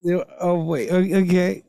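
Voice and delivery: normal talking voice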